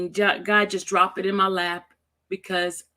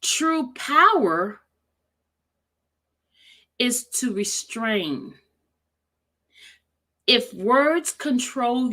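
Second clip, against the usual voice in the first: very slow, weighted read with long pauses; 2 semitones higher, 3 dB louder, slower than usual. Shown as a demonstration. Rereading a quote so viewers can feel it, slowly. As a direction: stretch each phrase and leave space after it. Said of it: "I'm gonna read it slowly".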